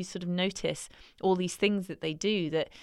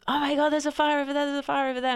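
higher, louder, fast alarmed cry; alarmed shout; 8 semitones higher, 4 dB louder, faster than usual. Retold or imitated. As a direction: yell out at spotting a fire. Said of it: "you point and you go".

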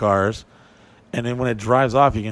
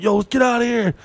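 high, urgent mock panic; freaking out; 12 semitones higher, 3 dB louder than usual. Teller urging the group to flee, high and urgent. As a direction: push everyone to leave. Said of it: "I'm freaking out".

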